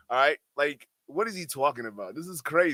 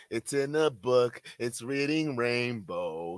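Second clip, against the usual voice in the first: mocking; 5 semitones lower, 5 dB quieter, slower than usual. Streamer mocks the guest.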